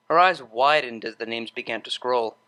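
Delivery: plain reading voice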